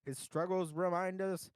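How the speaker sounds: earnest memorial speech voice; eulogy reading. Acted. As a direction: read it like a solemn eulogy. Reads a mock eulogy aloud.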